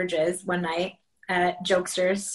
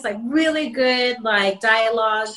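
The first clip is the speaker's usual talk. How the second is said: usual speaking voice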